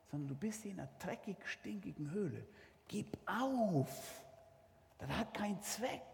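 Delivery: soft hushed voice, partly breathed; small quiet voice. Acted. Tempting voice speaks to David in the cave, hushed. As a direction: whisper it close, like a voice in the cave. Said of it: "eine kleine, leise Stimme".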